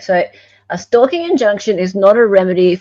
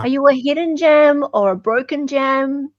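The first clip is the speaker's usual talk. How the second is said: silly teasing voice; 5 semitones higher, 3 dB louder than usual. Pretend.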